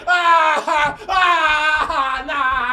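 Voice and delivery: high, loud yelps under blows; pained howls. Retold or imitated. Drunk recruit howling while beaten. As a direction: cry out as the flashlight hits.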